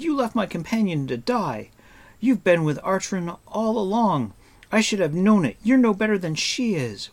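high-pitched, anguished accusation; shouting. A narrator's reading. Taran accusing Eilonwy of leaving his companion to die, shouting. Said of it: "he shouted".

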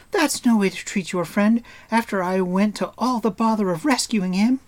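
put-on high, loud girlish scolding; crying out. A narrator's reading. Eilonwy scolding Taran for attacking the man she rescued, high-voiced. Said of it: "she cried".

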